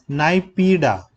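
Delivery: normal talking voice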